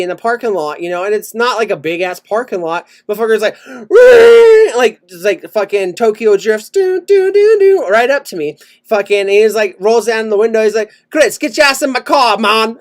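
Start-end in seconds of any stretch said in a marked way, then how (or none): 3.6-4.7 high, loud tire squeal; long piercing imitated skid; car screech noise. 6.7-7.9 high "doo doo" car sounds; sing-song imitation of a drifting car; drifting car noise. 11.1-12.8 imitated Jamaican accent, pitched up; mock Jamaican accent for the driver. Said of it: "this Jamaican guy".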